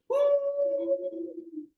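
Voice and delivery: very high whooping whistle; kettle whistle. Acted.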